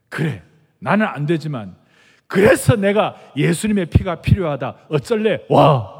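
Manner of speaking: loud, bold dare to the demon; forceful preacher's defiance; defiant challenge. Retold or imitated. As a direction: throw down the challenge, slowly. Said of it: "그러라고 그랬어요".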